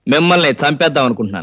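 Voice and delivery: low-pitched, measured menace; deadpan threat. Acted. Accused man threatening his accuser, deadpan. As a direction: deliver the threat low and level.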